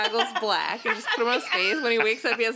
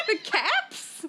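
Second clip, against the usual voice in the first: high laugh breaking into a question; surprised laugh. Real, not acted. Surprised by the accent.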